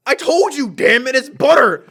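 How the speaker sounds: loud, high mock yelling; hammed-up cursing insistence; imitated angry outburst. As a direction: explode as a fed-up old customer. Customer explodes at clerk.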